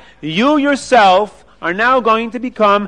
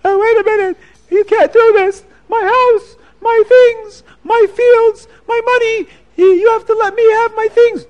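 high, loud, wailing plea; a ruined rich man sobbing in protest; crying rich man; 6 dB louder than usual. Acted. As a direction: beg for your things, voice breaking high. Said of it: "the rich man starts to cry".

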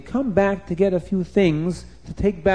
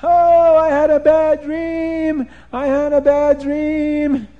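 high, loud, slow wail; Nimrod screaming; 12 semitones higher, 7 dB louder, slower than usual. A narrator's reading. Nimrod running round the palace after a nightmare, screaming. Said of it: "he ran around the palace in his pajamas screaming".

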